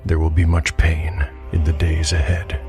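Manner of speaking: usual speaking voice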